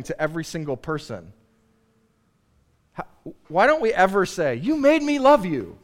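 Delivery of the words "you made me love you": high, loud, exclaimed blame; mock accusation. Preacher turning blame into love, high mock accusation.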